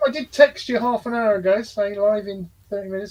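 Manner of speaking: squeaky high voice; high-pitched voice. Real, not acted. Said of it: "do you want to go any higher pitched".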